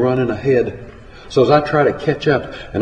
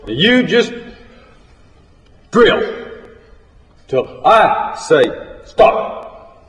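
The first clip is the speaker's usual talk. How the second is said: high-pitched, bossy dressing-down; scolding drunk boss, raised pitch; drunken foreman berating crew; 4 semitones higher, slower than usual. Acted. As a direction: order the crew back to work, drunk and angry. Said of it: "He starts to raising all kind of hell".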